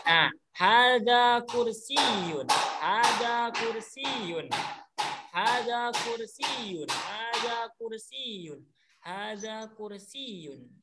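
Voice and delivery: slow, rising-pitch drill chant of each word; slow model repetition. Shown as a demonstration. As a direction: model it slowly for the class to repeat. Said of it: "Silakan, coba".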